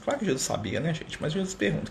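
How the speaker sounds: ordinary delivery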